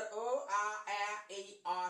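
अ, आ, ॲ, ए, अ as separate held vowels; vowel sounds demo. Shown as a demonstration. Teacher recaps the sounds of A, each vowel separate. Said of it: "असा उच्चार झाला".

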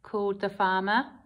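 usual speaking voice

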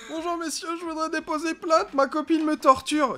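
high, whiny fake sobbing; mock crying. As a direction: whimper it like a crybaby. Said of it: "en pleurant".

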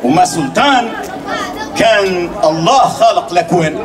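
put-on hakawati telling an old tale, unhurried; arabic grandma folk tale. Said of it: "اسمعوني توي". (صوت حكواتي يحكي خرافة)

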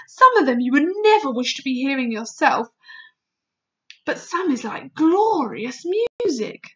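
loud, fervent giant; warn, then glow with wonder; awed excitement. Acted. Giant shares secret sounds with Sophie, loud and fervent. Voices the BFG, transfigured by excitement.

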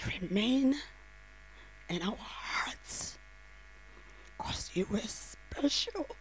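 whispered farewell to a son; real whisper. Real, not acted. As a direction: breathe the words out in a whisper. Mother's farewell at son's funeral.

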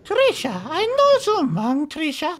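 high, quavery little granny voice; old lady voice. Acted. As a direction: coo like a sweet old grandma. Prank caller plays sweet old lady.

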